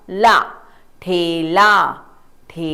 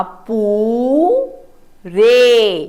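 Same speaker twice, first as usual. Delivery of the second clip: पू-रे pulled apart, पू held long; very slow syllables; 3 semitones higher, much slower than usual. Shown as a demonstration. Teacher to children, sounding out पूरे very slowly. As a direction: draw out पू then रे. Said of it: "प को ऊ की मात्रा".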